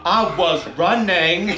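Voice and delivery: slow drawn-out Southern drawl; imitating Forrest, slow and simple; Forrest Gump voice. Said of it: "the one I always think is like".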